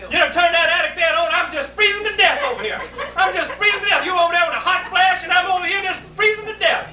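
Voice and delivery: high-pitched, fussy mock mother; complaining mother. Mother complains to the house that she is freezing, mock complaining.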